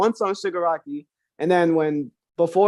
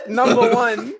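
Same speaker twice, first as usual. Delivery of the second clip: slow, higher, through real laughter; laughing; 4 semitones higher, 5 dB louder, slower than usual. Real, not acted. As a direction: draw it out while cracking up. Both hosts laughing at scene.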